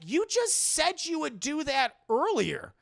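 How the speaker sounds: high, slow mock exasperation; high-pitched incredulous complaint; exasperated retort. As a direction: protest the contradiction, stretching the words. Exasperated retort to retold speech.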